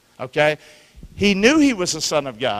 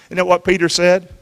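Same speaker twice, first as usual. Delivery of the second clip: ordinary delivery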